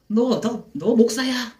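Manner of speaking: high-pitched mock shout; acted-out yelling at a pastor; retold yell. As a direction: imitate someone yelling a challenge, not at full volume. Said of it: "하면서 막 소리를 지르시는 거예요".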